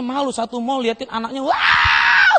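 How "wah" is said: long, rough mock wail; rocker-like tantrum scream. Child wails in the mall, long rocker scream. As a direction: scream "waaah" like a rock singer. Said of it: "seperti rocker kan".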